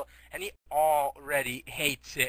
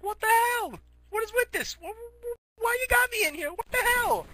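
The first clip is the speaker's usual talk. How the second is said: very high-pitched, stammering protest; squeaky mock panic. Hero waking up in a jail cell, squeaky mock-panicked protest.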